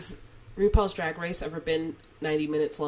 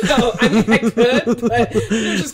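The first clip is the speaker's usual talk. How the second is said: loud, high, helpless laughing; 5 semitones higher, 11 dB louder, slower than usual. Real, not acted. Editor defending herself to co-host, laughing loudly. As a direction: talk right through a laughing fit.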